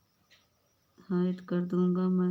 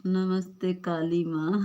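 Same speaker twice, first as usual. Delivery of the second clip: normal talking voice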